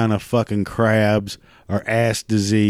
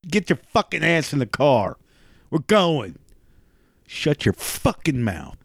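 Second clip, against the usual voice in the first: mock husband snapping; 5 semitones higher than usual. Husband snapping at vacation-begging wife.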